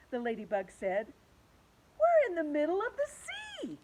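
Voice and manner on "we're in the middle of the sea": high, loud cry; alarmed cry. Acted. James cries out to the others, high and alarmed. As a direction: call it out in alarm. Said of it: "cried James".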